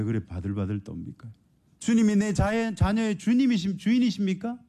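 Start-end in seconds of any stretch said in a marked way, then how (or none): 1.8-4.6 high, ringing preacher's challenge; fervent preaching. Acted. Preacher challenging the congregation, ringing high cry. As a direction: call it out to the whole church.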